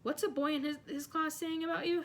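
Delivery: a hint of a curious girl; girl's voice. A narrator's reading. Hermione's voice in story.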